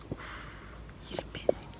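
whispered loving squeeze. Real, not acted. Mother whispering how much she adores her daughter. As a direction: breathe it with adoration.

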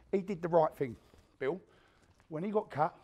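ordinary talk

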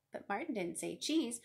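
ordinary delivery, nothing marked

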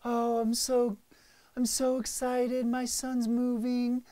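mock-excited proud mom; imitating mom. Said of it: "she's like".

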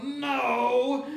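high, loud, drawn-out shout; all-out yell. Acted. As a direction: yell it with the whole crowd. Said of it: "all yelled".

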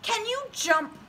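very high, tiny tadpole voice. Acted. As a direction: squeak it like a baby tadpole. Tadpole asks.

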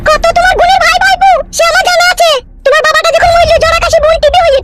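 high-pitched nagging wife voice; scolding wife. Acted. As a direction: rail at your useless husband in a high voice. Wife orders relatives out.

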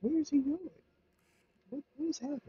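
high, hushed disbelief; panicking gamer. Real, not acted. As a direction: squeak in baffled disbelief. Baffled, worker gone to bar.

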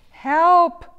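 very loud, high yell; cry for help. A narrator's reading. Pouch shows a one-word prayer, loud high cry. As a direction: yell it out at full force. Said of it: "pray with just one word".

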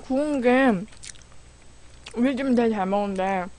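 chewing while talking; sipping soup from spoon; mouth full. Real, not acted. Seen 2: chews between words, then spoon at lips.

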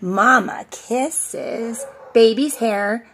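ordinary delivery

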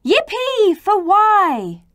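high, loud, drawn-out cheer. A narrator's reading. Children celebrating Y's claim, big cheer. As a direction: cheer it slowly and loudly. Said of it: "The children cheered".

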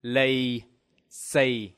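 "Lì xì" said slowly, syllable by syllable, low falling tones; Vietnamese pronunciation. Shown as a demonstration. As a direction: model "Lì xì" with low level tones. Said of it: "Now repeat after me".

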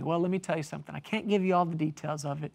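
usual speaking voice

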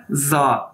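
"जा" with a z onset and long aa; Chinese ZA pronunciation. Shown as a demonstration. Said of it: "Chinese में कैसे बोला जाता है".

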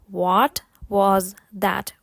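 slow, word-by-word demonstration. Shown as a demonstration. Teacher models the question for learners, slowly. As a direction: say it slowly with each word separate. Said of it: "повторити повільно і потім спробувати швидше".

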